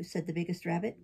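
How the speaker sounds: plain reading voice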